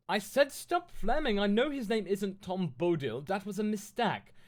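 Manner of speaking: high, loud indignant rant; worked-up, high-pitched telling-off; ranting fanfic author. Acted. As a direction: read it as a petulant tirade at the critics. Reads the author's note in voice.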